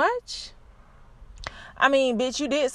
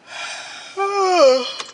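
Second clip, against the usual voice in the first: long, heavy sigh of tiredness; a drawn-out sigh after a long day; tired sigh; 8 semitones higher, 4 dB louder than usual. Real, not acted. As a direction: let out a weary sigh. Tired, no delivery orders.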